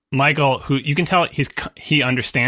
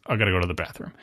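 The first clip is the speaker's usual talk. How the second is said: low, much softer, flat statement with no asking; clipped and low, not asking permission; curt announcement; 8 semitones lower, 7 dB quieter, faster than usual. Retold or imitated. Michael tells the men he's leaving, low and curt. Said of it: "He does not ask for permission".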